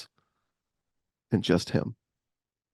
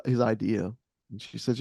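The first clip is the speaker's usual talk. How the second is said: usual speaking voice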